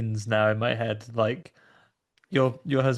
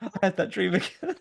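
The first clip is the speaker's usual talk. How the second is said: mock waking from nightmare; 6 semitones higher than usual. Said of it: "sitting up and going".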